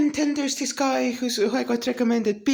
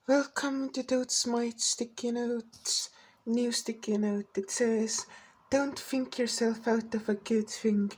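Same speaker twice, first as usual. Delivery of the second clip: slow, soft segment intro; hushed, measured show-intro delivery; sticky-note reading; 8 dB quieter, slower than usual. Acted. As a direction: read a note aloud slowly and quietly.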